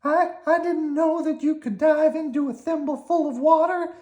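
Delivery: high squeaky worm; high, cheerful little worm. Acted. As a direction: pitch it up like a tiny worm.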